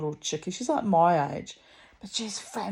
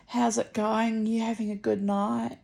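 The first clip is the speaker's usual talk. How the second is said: lightly voiced young man, pitched higher; 6 semitones higher than usual. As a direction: imitate a young man's chatty greeting, higher. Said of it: "this young man walks up the street".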